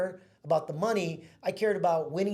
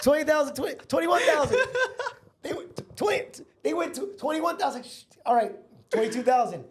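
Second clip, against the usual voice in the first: much higher, louder, laughing through the numbers; laughing excitement; 11 semitones higher, 7 dB louder than usual. Retold or imitated. Recounting the bidding war to friends, excited and laughing. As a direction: let the bidding frenzy crack into laughter.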